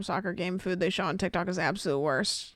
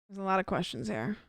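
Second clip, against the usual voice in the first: ordinary talk, nothing marked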